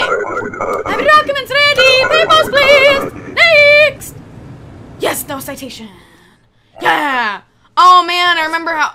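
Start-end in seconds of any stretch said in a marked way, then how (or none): 0.0-4.1 very high, loud drawn-out call; high-pitched mock official's shout. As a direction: holler slowly for the next in line. Calling out to next entrant in game.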